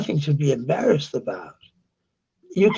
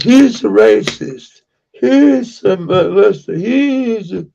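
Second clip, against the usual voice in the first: higher, louder mock accusations; mock accusing; 5 semitones higher, 6 dB louder than usual. Streamer mimicking people who judge without knowing, mock accusing. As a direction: parrot the accusers with raised pitch and volume.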